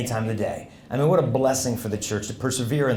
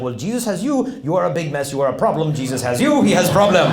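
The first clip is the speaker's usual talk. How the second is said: French priest building to a louder finish; French accent; 2 semitones higher, 3 dB louder than usual. Retold or imitated. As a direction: drive the point home with a French accent. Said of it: "there's a priest visiting from France".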